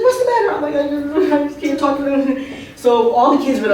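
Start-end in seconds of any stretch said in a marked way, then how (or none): 0.0-0.5 very high-pitched son's voice; mock-alarmed high question; worried son. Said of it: "He's like". 0.7-2.4 higher, whiny tearful voice; hammed-up weepy complaint; mock crying. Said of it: "I started crying".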